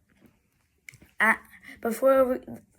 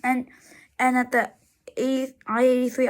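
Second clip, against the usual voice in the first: usual speaking voice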